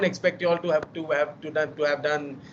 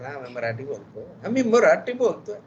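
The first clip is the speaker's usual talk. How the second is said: fast, hammed-up bad Marathi accent; mock his own terrible Marathi accent, fast; fake Marathi accent; much faster than usual. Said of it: "Very, very fake Marathi accent".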